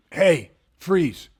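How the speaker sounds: half-hearted shouted order; retold yell. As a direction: imitate a shouted command at half strength. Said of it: "When he yelled".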